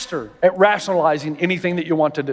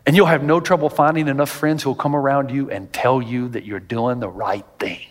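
usual speaking voice

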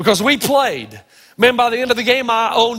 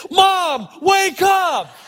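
retold wake-up shout; 7 semitones higher, much slower than usual. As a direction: yell at Mom to wake her. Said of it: "Kyle Idleman says".

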